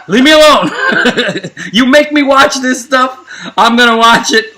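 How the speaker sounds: loud, high mock outburst through laughs; hammed-up indignant retort; defensive protest. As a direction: protest as if caught crying, played for laughs. Retold protest when caught crying.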